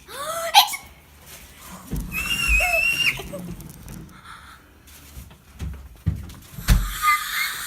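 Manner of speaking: a long, high, loud gasp of delight. Real, not acted. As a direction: gasp and squeal as you see the bird. First sight of new bird.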